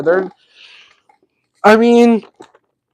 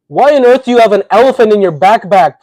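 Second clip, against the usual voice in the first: ordinary delivery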